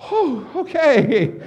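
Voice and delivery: slow, loud high "whew" before starting; flustered bracing. Retold or imitated. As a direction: act out bracing yourself, drawn out and high. Stalling before an unknown page.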